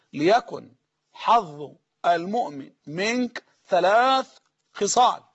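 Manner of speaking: very slow and deliberate, word by word; slow dictation. Shown as a demonstration. Preacher dictating to listeners taking notes, very slow. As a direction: spell it out slowly so they can write it down. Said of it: "عشان الإخوة والأخوات اللي بيقولوا إحنا بنكتب وراك".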